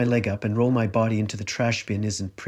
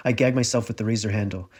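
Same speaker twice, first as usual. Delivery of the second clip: plain reading voice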